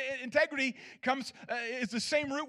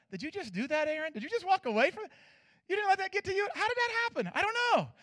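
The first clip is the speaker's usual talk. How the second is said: higher, astonished questions to himself; amazed self-talk; 8 semitones higher, faster than usual. Retold or imitated. Aaron marveling at himself after the angry neighbor, high amazed voice. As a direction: marvel at yourself in disbelief. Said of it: "and I went".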